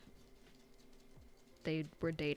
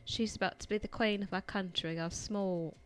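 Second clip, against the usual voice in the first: hammed-up British movie prince; British-accented royal announcement, lightly mocking; 2 semitones higher than usual. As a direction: put on an American's fake British accent. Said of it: "the dude has a British accent".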